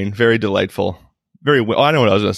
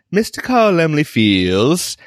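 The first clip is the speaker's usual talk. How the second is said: higher, slow announcer to a crowd; drawn-out mock opening speech; lightly put-on presenter cadence; imitating a stage presenter; 6 semitones higher, slower than usual. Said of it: "a guy comes out and speaks to the crowd".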